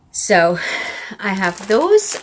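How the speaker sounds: out of breath. Real, not acted. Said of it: "I'm sorry if I'm so out of breath".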